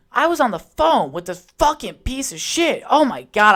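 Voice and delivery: high, loud exasperated rant; frustrated. Real, not acted. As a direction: let real frustration burst out. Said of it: "I'm getting frustrated thinking about it".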